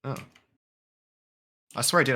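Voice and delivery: usual speaking voice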